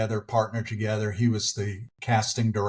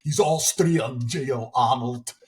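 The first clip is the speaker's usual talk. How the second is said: slow Austrian-accented action star impression; mock Austrian accent, drawn-out; Schwarzenegger; slower than usual. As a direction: drag it out with a thick Austrian accent. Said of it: "my German accent is, is confined to Schwarzenegger".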